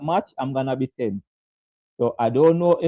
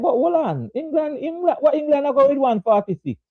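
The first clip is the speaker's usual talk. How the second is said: higher, mock-incredulous "what England are going"; retold disbelief; 7 semitones higher than usual. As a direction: replay the shock at the total, pitched up. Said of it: "I said".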